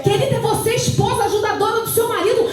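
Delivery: impassioned church proclamation; fervent preaching. Real, not acted. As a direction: urge the congregation to believe, pitched high. Exhorts the congregation with fervour.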